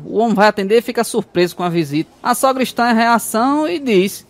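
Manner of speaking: usual speaking voice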